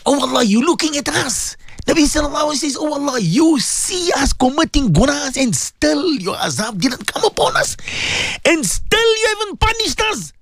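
high, strained pleading to God; anguished confession. Real, not acted. Anguished confession of sins to Allah.

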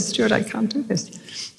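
soft, slow, pleading to her husband; quiet plea. Retold or imitated. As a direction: say it softly, almost giving up.

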